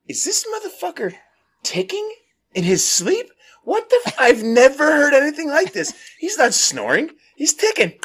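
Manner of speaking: squeaky high, incredulous questioning; baffled disbelief. Retold or imitated. Awake beside a ticking sleeper, high-pitched incredulity. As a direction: jump way up in pitch, hammed-up bewilderment.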